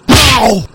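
sharp high comic "ow"; mock yelp of pain. Comic reporter yelping as the news breaks. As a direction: yelp loud as if hurt.

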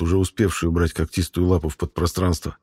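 plain reading voice

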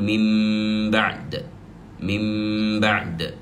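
"من بعد" with a long hummed m before the ب; slow recitation, "من" turned into a sustained "mimm" before "بعد"; lip-hidden nasal. Shown as a demonstration. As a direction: hold a nasal hum on the lips in "من بعد", then release the ب. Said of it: "من الشفتين".

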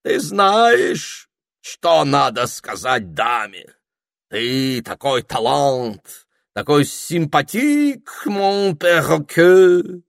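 put-on slow, flattering French accent, high and loud; французский акцент. A narrator's reading. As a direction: gush over the parrot in a French accent. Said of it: "с сильным французским акцентом". (кокетливо и протяжно, с французским акцентом)

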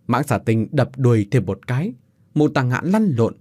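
plain reading voice

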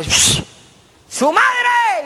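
high, drawn-out yell; shouted insult. Acted. Spectator yells at bobsledders racing past, shouted insult. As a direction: heckle from far off at the top of your voice. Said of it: "yo les grité".

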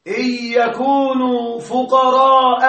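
فقراء ممدودة دون تنوين، ببطء; قل فقراء بلا تنوين في آخرها; بدون تنوين. Shown as a demonstration. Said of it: "بدون تنوين". (نطق فقراء دون تنوين)

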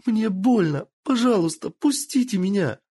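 high, whiny tearful plea; whimpering. Acted. Tortured girl pleading with the lord, whimpering. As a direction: whimper like a hurt child. Kitsune begging in pain.

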